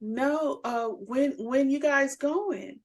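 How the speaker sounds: lightly voiced mother asking about plans; imitated mother. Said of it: "she said".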